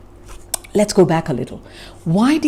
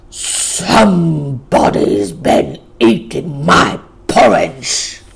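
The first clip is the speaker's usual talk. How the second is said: low, slow and loud growl; gruff big bear; 5 semitones lower, 4 dB louder, slower than usual. Acted. Great huge bear growling about his porridge, low and loud. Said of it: "growled in a big, gruff voice".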